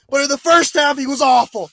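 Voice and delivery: usual speaking voice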